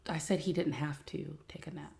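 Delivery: a secret whisper to the viewers. Real, not acted. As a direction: whisper it so the next room can't hear. Whispered aside about upset son.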